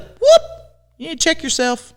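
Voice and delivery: very high whoop, then a sassy warning; check yourself. Retold or imitated. Friend to friend acting out, high sassy warning. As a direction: snap them back with a teasing call-out. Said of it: "be like".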